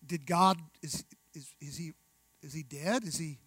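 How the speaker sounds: soft, halting and hopeless; a lost believer mumbling in despair; despairing mutter. Acted. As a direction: trail off like someone who has given up. Preacher acts a despairing believer.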